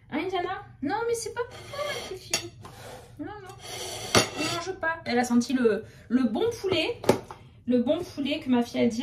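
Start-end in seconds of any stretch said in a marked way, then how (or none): 0.1-5.0 higher, softer cooing at a pet; talking to a dog. Real, not acted. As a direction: gently tell the dog no, sweetly. Scolding dog sniffing plates. (voix aiguë et douce pour parler à la chienne)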